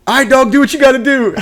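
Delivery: high-pitched yelled send-off; mock hasty call, pitch way up. Leaving the gunner before the airstrike, hurried mock yell. Acted shout leaving comrade.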